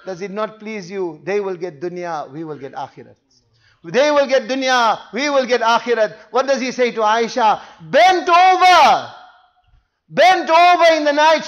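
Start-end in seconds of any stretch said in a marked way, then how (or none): 0.1-3.2 low, hushed and sinking; soft consolation. Acted. The Prophet promising Umar the akhirat, hushed. 7.8-11.5 loud, high, drawn-out words; dramatic cry. Real, not acted. Preacher picturing the Prophet at night, crying out.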